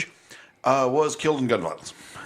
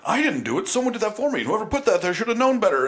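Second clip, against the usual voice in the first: much higher, whiny mock of a comic dodging blame; mocking whiny excuse; 10 semitones higher, faster than usual. Host mocks Nick DiPaolo's excuse in a high whiny voice. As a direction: whine it like a man pretending he's the victim. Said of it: "he's like".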